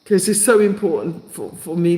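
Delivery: tearful, breaking off to recover; choked-up and faltering; crying. Real, not acted. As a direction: talk through real tears. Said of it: "Can't stop crying".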